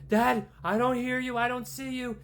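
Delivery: high, loud frightened begging; scared pleading. Acted. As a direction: plead like a terrified child. Daughter panicking on phone.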